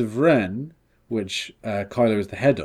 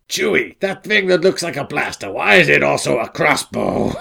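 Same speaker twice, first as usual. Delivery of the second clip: mock Han; 3 semitones higher, 3 dB louder than usual. Mock Han asking Chewie.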